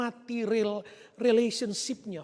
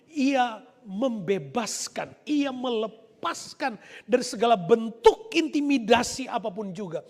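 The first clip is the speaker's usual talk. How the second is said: ordinary delivery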